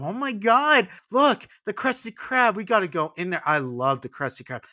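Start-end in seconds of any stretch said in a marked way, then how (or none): 0.0-3.4 high, loud gushing visitor; excited fans. Retold or imitated. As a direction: squeal like a superfan spotting the Krusty Krab. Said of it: "the people alongside you go".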